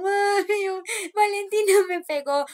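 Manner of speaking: high, loud whining complaint; whiny child. Retold or imitated. Little girl crying to mom that her sister hit her, whiny. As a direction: whine like a little kid tattling.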